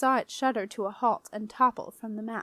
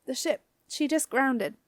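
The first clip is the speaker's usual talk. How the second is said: slightly raised, squeaky report; frightened squeak; 3 semitones higher than usual. A narrator's reading. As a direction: squeak it out nervously. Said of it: "managed to squeak".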